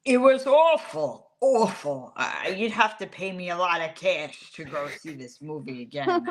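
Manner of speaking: slow, grumpy Long Island sports radio voice; Mike Francesa impression. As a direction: drag out awful like a cranky talk-radio host. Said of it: "can you do Mike Francesa reviewing Superman?".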